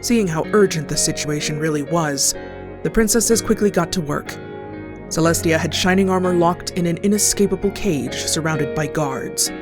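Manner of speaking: usual speaking voice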